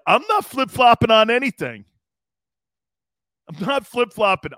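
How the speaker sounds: NOT hit hard and loud; stress on not. Real, not acted. Host insists to Xander, stressing "not". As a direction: lean into "not" to deny it. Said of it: "You can't start flip-flopping".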